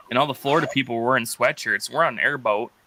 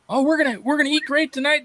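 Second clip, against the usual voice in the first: Southern gal; 13 semitones higher than usual. Retold or imitated. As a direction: mimic a thrilled Southern lady, pitched up. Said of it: "the gal's saying".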